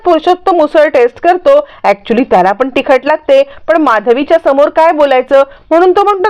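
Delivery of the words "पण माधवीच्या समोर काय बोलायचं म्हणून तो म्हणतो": ordinary delivery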